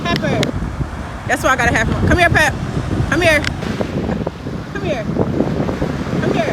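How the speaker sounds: loud dog-calling. Real, not acted. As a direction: call the dog back firmly.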